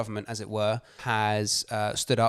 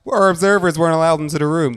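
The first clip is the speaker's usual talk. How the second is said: high, loud, fast American mock voice; mock the Trump team in American; American accent; 6 semitones higher, 8 dB louder, faster than usual. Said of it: "That is a better accent".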